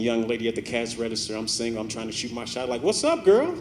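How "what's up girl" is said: flirty. Retold or imitated. Shooting his shot at cashier.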